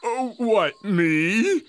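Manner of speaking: who, me? surprised; startled protest. Acted. Henchman ordered to scout.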